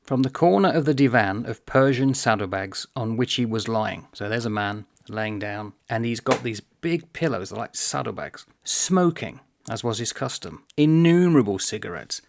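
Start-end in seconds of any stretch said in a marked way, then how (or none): 0.0-4.1 measured, deliberate read-aloud; slow, careful reading with room around the words. Shown as a demonstration. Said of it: "but slowly". 8.6-12.2 deliberate reading, key words lifted; slow reading. Shown as a demonstration. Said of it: "but slowly".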